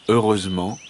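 ordinary delivery

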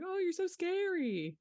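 mock fright. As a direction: tease with a high, swooping pretend fear. Mocking quote of Danny teasing Max.

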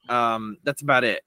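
normal talking voice

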